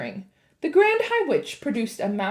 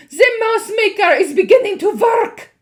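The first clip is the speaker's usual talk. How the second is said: witch shrieking; 14 semitones higher, 14 dB louder than usual. A narrator's reading. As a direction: shriek in triumph. Witch exults.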